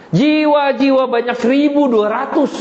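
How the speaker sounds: high, insistent acted appeal; impassioned plea. God defends Niniwe to Yunus, high impassioned plea.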